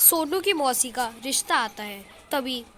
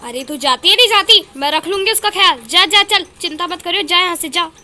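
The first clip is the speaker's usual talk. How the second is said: loud, high, brusque shooing; impatient shouting; 4 semitones higher, 8 dB louder than usual. Acted. Elder sister shooing departing Shakuntala, loud and impatient. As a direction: shout her out the door impatiently.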